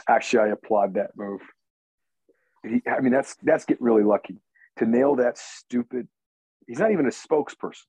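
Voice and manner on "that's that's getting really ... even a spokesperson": normal talking voice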